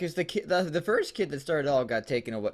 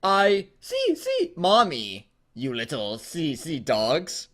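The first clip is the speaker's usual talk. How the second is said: robot fox voice; 5 semitones higher, 4 dB louder, much slower than usual. Acted. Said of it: "sounded like a mere whisper".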